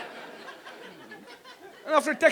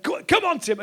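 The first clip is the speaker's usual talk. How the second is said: crowd cheer; 7 semitones higher than usual. Retold or imitated. Crowd chant retold.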